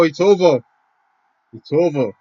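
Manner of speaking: loud high joyful yell; jubilant shout. Real, not acted. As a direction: scream it as the match ends. Final whistle, watching live.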